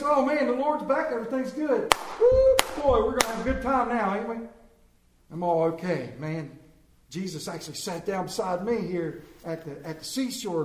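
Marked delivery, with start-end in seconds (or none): 0.0-4.4 high, loud celebratory whoop; hammed-up glee, pitched way up; excited Peter. Peter thinks the Lord is back for good, acted giddy excitement. Acts out Peter's happy thoughts.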